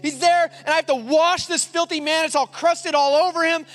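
high, disgusted rant; worked-up retold indignation; angry. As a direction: re-enact a judgmental outburst. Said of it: "and I'm angry now".

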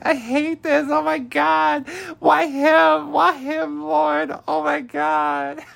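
very high, loud mock wailing; hammed-up bawling. Recapper voices Hannah losing it over Manny, hammed-up bawling. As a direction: wail melodramatically in a squealing fake sob. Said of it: "She starts bawling her eyes out".

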